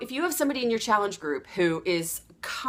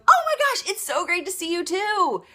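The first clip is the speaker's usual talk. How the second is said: much higher and louder, matching her joy; echoed excitement; 9 semitones higher, 4 dB louder than usual. Shown as a demonstration. Coach showing how to echo a friend's joy, beaming. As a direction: throw the excitement right back at her. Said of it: "you echo back what they have".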